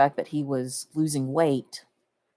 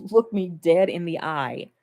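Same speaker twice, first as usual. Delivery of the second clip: ordinary delivery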